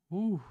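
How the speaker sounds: sharp high gasped ooh; nervous high ooh. Real, not acted. Price reverses.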